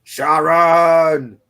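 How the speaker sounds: loud, high drawn-out call; mock British accent. Podcaster clowning about a British rock singer, mock-British holler. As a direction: belt the name out long and high. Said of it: "my British accent on".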